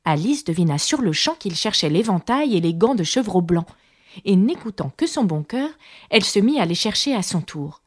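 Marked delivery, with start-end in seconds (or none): none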